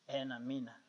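low, very soft repeat; stunned, quiet aside; hushed echo. Real, not acted. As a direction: drop to a murmur after the climax. Stunned quiet echo after the peak.

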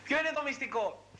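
normal talking voice

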